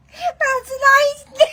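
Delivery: "That's an oyster" high and slow, close to a wail; high whiny repeat. Acted. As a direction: whine it out, drawn out high. Repeat higher, slow, crying tone.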